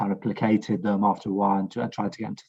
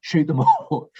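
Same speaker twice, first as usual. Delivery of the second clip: higher-pitched mocking quote, breaking into a laugh; mock buffoon; 5 semitones higher, 3 dB louder than usual. Veteran quoting online buffoons to his co-host, mocking. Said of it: "buffoons who were like".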